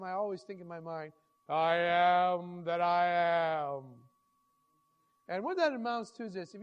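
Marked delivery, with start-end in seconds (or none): 1.5-3.8 slow, loud and drawn out, every word weighted; a slow, loud, solemn pulpit declaration; booming proclamation. Acted. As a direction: proclaim it like the voice of God. Preacher proclaims God's name.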